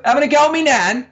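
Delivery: cheeky Cockney question, rising; mock Cockney English accent. Twin answering twin in mock Cockney accent. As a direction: ham up a London accent. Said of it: "our proper English accents on".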